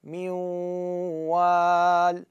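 من وال with the nasal ghunna stretched very slowly; two-count ghunna. Shown as a demonstration. Teacher exaggerating the held ghunna. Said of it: "مقدار حركتين أتكئ".

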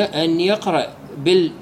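usual speaking voice

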